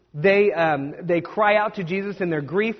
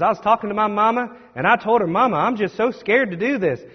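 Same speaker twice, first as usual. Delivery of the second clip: put-on deep, thick accent, pitched up; Sadie Robertson; 4 semitones higher than usual. Preacher quotes a young woman preacher in her deep, thick accent. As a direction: imitate her thick accent, higher than your own voice. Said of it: "she got that deep, thick accent".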